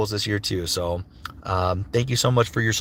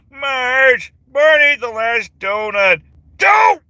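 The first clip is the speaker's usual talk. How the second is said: high, loud cartoon wailing; Homer Simpson; 12 semitones higher, 6 dB louder, much slower than usual. Homer wailing about the last donut, loud and high. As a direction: whine it long, then yelp the last word. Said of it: "a Homer Simpson impression".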